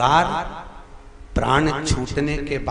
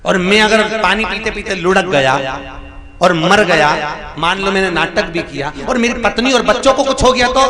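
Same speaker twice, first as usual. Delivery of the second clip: fast, louder worried protest; alarmed seth; 5 semitones higher, 5 dB louder than usual. Acted. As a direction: push back in a panic.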